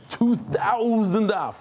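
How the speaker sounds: stretched-out amazement; awed emphasis. Real, not acted. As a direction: marvel at the number. Awed by huge exam size.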